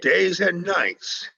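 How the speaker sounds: slow, weighted "Days and Nights" with "and" drawn out; slow and deliberate. Acted. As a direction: read "Days and Nights" slowly, stretching "and". Reads out the inscribed title.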